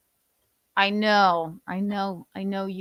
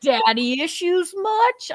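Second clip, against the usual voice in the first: mocking sneer; 7 semitones higher, 3 dB louder, slower than usual. Mocking quote, slow.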